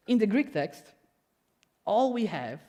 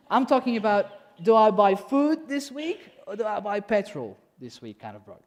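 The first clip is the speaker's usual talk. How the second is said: emphatic; 3 semitones higher, 6 dB louder than usual. Real, not acted. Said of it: "emphatic sermon point".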